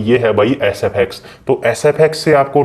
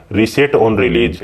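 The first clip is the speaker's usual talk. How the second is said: slow label read; much slower than usual. Real, not acted. Reading out a setting name.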